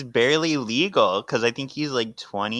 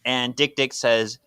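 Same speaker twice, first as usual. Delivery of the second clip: ordinary talk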